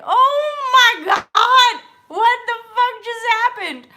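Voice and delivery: high, loud, slow shriek; screaming with relief after the ordeal; disbelieving shriek. Retold or imitated. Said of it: "And I was just like".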